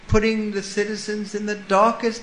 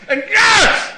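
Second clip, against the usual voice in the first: yes! shouted much higher and louder; sudden shout; 10 dB louder than usual. Acted. Storyteller to the congregation, a sudden high shout. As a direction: burst out as the blade falls.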